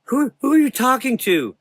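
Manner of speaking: put-on high, loud scared boy voice; frightened boy. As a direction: stammer it out in fear.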